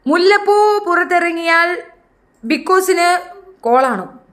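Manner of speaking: high, slow, held-out announcement; sustained high announcer call; mock proclamation. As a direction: proclaim it in drawn-out syllables. Mock proclamation after tea call.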